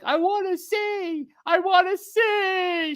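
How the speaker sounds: very high, slow whiny wail; mock toddler sobbing to see; imitated crying child. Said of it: "She started crying".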